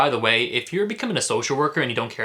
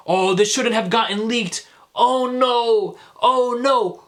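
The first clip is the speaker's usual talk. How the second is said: mock whiny fretting, pitched higher and a bit louder; mocking conservatives; 9 semitones higher, 4 dB louder, slower than usual. Mocking conservatives upset about the leak, in a high, whiny voice. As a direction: whine it like a fake-worried conservative. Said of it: "Conservatives are really out here acting like".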